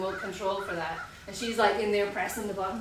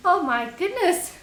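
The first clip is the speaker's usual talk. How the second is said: higher, louder stunned exclamation; shocked; 7 semitones higher, 7 dB louder than usual. Real, not acted. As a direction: gasp it out in disbelief. Reacting to door scare.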